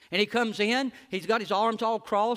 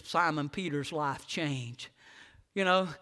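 ordinary delivery

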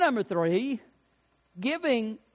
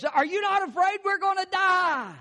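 panicked castaway; 11 semitones higher than usual. Retold or imitated. Panicking castaway asks the other.

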